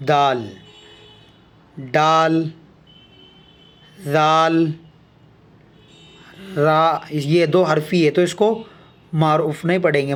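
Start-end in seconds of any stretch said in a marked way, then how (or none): 0.1-4.7 slow, clear recital of each letter name; letter names recited. Shown as a demonstration. Teacher reciting letter names slowly for the class. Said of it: "تو چلیے پڑھتے ہیں".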